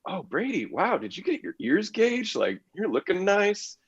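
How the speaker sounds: high, put-on gushing friend voice; mock compliment. Friends teasing Brady about his new look, high gushing voice. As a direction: gush over him in a silly high voice.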